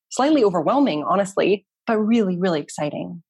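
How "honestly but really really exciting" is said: ordinary talk, nothing marked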